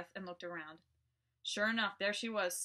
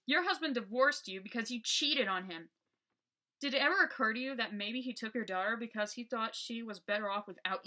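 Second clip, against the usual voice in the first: angry; 4 semitones higher, 6 dB louder than usual. Acted. Berating the ghost underfoot.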